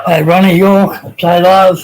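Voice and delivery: loud, cheery shout-out to a viewer; calling out a greeting. Real, not acted. Streamer hailing a viewer in chat, loud and cheery. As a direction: call his name across the room, bright and loud.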